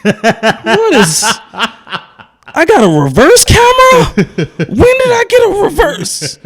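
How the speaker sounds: high, loud squeal through laughter; cracking up in high-pitched disbelief; giddy laughing. Real, not acted. Host ribs guest about a reverse camera, giddy laughing.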